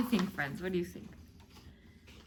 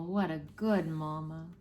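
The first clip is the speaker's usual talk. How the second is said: plain reading voice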